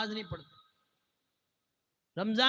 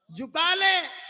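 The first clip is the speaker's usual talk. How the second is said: raised, higher exhortation; emphatic urging; 6 semitones higher, 5 dB louder, much slower than usual. Real, not acted. Preacher pressing listeners to bow, emphatic urging. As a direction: call it out insistently. Repeats exhortation more forcefully.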